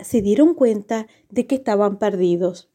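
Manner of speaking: plain reading voice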